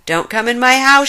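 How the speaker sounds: loud angry warning; angry shout. A narrator's reading. Polecat chasing intruders from his house, angry shout. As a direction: shout them off your doorstep. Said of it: "shouted Polecat".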